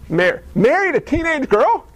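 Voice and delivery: high, stunned question; incredulous Gabriel. Acted. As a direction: Gabriel can't believe his ears. Gabriel questions God in disbelief.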